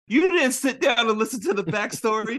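high, loud mock yell, laughing; furious Nami. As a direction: chase him off, shouting. Nami yells at Luffy, retold.

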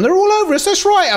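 high sarcastic jeer at the opposition; mocking whine. Manager mocking the complaining opponents, sarcastic jeer. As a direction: needle them in a sing-song voice.

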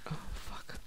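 hushed frightened whisper; whispered curse. Real, not acted. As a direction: breathe it out in a whisper. Scared in dark game.

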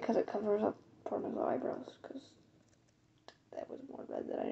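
soft whispered aside; whispering. Real, not acted. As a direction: whisper it to the camera.

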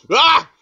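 high, loud burst of laughter; character laugh. Acted. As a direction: try out a big high-pitched cackle. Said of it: "need to find a laugh for him".

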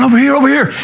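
mock urgent summons; calling out. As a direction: imitate bystanders waving someone over. Crowd calling for the doctor.

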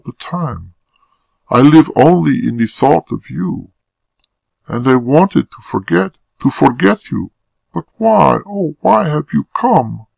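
high-pitched, distressed woman's voice; unhappy woman in love. Acted. Woman confessing love to her lover, unhappy and pleading.

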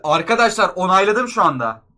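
real shouted shock at what just happened. Real, not acted. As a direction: blurt it out at full volume. Shocked at confirming the purchase.

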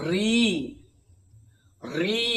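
"রি, রি" with the i held long; long ri sound. Shown as a demonstration. Teacher to class, modelling long "রি" stretched. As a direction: stretch the vowel of "রি" out. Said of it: "তাই এর উচ্চারণটা হবে".

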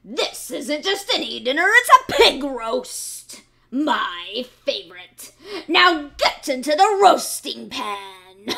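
loud, slow gleeful gloat; gloating fox. A narrator's reading. Fox gloating, ordering piglet into pan. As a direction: savor the pig roast. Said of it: "said the fox".

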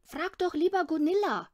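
put-on a small boy dodging the question cunningly; sly little boy. A narrator's reading. Boy slyly deflects mother's question, high and crafty. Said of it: "sagte Lillebror listig".

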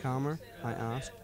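usual speaking voice

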